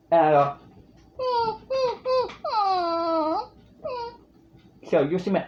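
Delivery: put-on strained crying baby wail. Shown as a demonstration. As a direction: cry like a newborn, throat tight. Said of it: "Baby cry".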